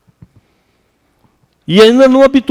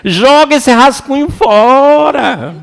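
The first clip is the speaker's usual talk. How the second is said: slow, higher, louder order to toss it; firm advice; 7 semitones higher, 4 dB louder, much slower than usual. Retold or imitated. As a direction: tell her slowly to throw the draft away. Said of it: "Eu falei".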